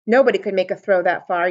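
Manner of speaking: ordinary delivery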